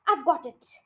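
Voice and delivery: ordinary delivery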